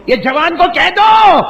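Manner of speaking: sudden high, loud command; stern shout. Acted. God ordering the angels against the cruel son, shouting. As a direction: bark the order down from heaven.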